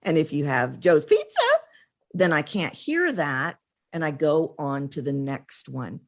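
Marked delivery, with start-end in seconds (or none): 0.8-1.5 mock perky greeting, rising high; Joe's Pizza girl. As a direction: chirp it with forced happiness. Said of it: "she's talking like this".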